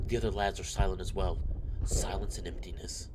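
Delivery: subdued visual-novel narration; story narration. Acted. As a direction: read the soldier's thoughts aloud in a measured voice. Reads the visual novel aloud.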